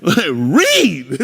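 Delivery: loud, stretched stress on READ; emphatic comic punch on READ. Acted. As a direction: hit READ hard and draw it out. Repeats reading for emphasis.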